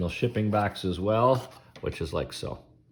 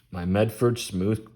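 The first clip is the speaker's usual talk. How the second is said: speaking through hiccups; interrupted by hiccups; 2 semitones higher, slower than usual. Real, not acted. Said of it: "Excuse me, I got the hiccups".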